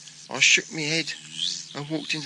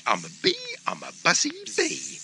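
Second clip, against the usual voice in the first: high bee voice; high tiny bee boasting; squeaky bee; 13 semitones higher than usual. Acted. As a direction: sing out as the proud bee. Bee announces itself.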